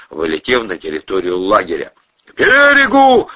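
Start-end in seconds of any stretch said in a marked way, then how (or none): 2.2-3.3 loud, high, drawn-out yell; full-voiced high shout, words stretched; yelling an order. A narrator's reading. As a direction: bellow the order to the driver. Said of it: "орал Валерий".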